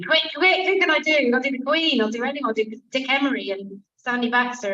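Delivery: high, loud, frantic inner panic; panicked thoughts. Retold or imitated. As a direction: scramble for any impression in a panic.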